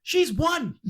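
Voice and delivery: high, loud mock protest; hammed-up fussy father; mock indignant dad. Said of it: "Data retort, laughing".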